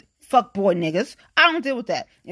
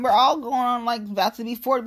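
ordinary delivery

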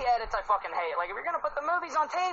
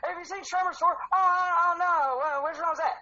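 higher-pitched, lightly acted confused fan; mildly flustered, searching for the answer; puzzled people figuring out; 6 semitones higher than usual. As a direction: play someone trying to work it out. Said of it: "sounds like half the people when they're trying to figure out".

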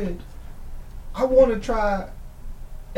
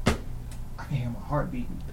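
hushed, dazed; 5 semitones lower, 9 dB quieter than usual. Retold or imitated. Dazed on drug, quiet.

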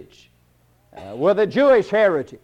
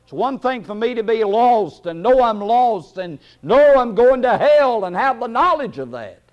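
high pulpit voice, building in force; raised, fervent sermon cadence; forceful preaching; 5 semitones higher than usual. Real, not acted. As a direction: preach it harder with each phrase.

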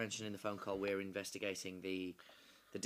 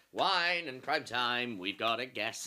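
podcast host announcement; 2 semitones higher, 8 dB louder, slower than usual. Acted. Announcing show opening to guest.

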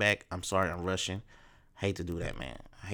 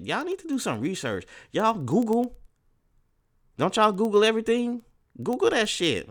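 much higher, louder, incredulous; exasperated; 12 semitones higher, 4 dB louder than usual. Real, not acted. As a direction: scold them in disbelief. Exasperated at ignorant Facebook people.

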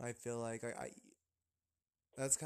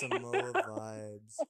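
slow echo; 2 semitones lower, 7 dB louder, much slower than usual. Retold or imitated. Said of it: "So I'm sending you Samoa vibes".

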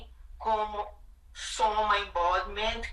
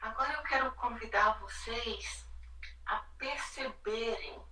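calm, hushed, sinking into quiet; soothing spa whisper; 7 dB quieter than usual. Acted. Leads a guided body meditation.

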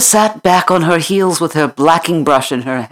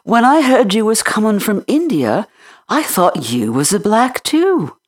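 higher, lively country housemaid voice; chatty Yorkshire maid; 7 semitones higher than usual. Acted. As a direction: rattle on like a talkative maid. Martha chatters.

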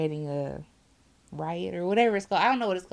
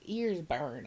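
normal talking voice

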